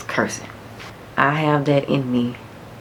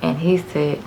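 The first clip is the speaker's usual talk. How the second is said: normal talking voice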